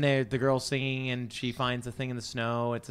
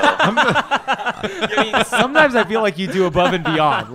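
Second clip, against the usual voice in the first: much higher and louder through laughter; laughing hard; 9 semitones higher, 10 dB louder, slower than usual. Real, not acted. Co-host ribbing the interrupter, laughing hard. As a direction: squeal it out mid-laugh.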